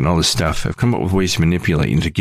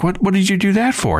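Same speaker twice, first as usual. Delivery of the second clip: much higher, puzzled what-did-you-do-that-for; mock bewildered customer; baffled question; 13 semitones higher than usual. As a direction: ask it with wide-eyed confusion. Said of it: "I'm just like".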